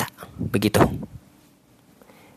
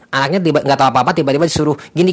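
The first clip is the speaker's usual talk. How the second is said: ordinary delivery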